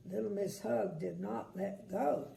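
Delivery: usual speaking voice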